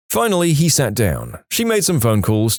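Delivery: usual speaking voice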